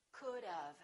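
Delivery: "could've" said as could-of. Shown as a demonstration. Teacher demonstrates "could've" as could-of. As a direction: blend "could've" with an "of" sound. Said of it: "It sounds like this".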